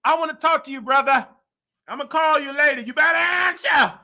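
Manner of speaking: loud, high, bossy caller yelling for the CEO; mock demanding shout. Ranting at the phone company, a loud demanding yell.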